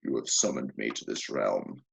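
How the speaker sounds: deep low demon voice; pit fiend demon. Acted. Pit fiend answers the cultists, deep and booming. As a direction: rumble it low like a summoned fiend. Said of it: "and then".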